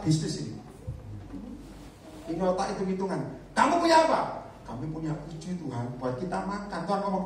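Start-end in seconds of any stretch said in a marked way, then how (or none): 3.5-4.2 higher, louder firm question; firm questioning teacher; commanding Jesus. Retold or imitated. Jesus asking disciples. 4.7-6.8 softer timid reply; soft sheepish disciple; meek disciple. Retold or imitated. Disciples answer meekly.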